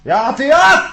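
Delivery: high, loud call for Atiyat; shouting a name to be heard. Acted. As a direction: yell her name across the house. Calling out for the maid.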